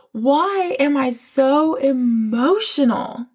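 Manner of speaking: drawn-out, slow mock puzzlement; slow bewildered self-quote. To herself during prayer, slowly drawn-out bewilderment. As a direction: stretch each word as if lost in tears. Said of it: "And I'm like".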